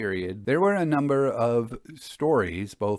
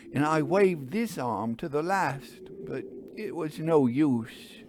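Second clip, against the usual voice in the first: higher, urgent railwayman voice; agitated engine driver; 7 semitones higher than usual. Acted. Engine driver tells gentleman of accident, shaken and urgent. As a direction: tell it like a shaken driver reliving it.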